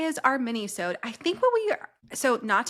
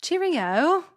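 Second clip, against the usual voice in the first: higher-pitched mock British farewell; British accent; 7 semitones higher, 4 dB louder than usual. Shown as a demonstration. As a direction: put on a playful British accent. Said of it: "Got a little British accent there".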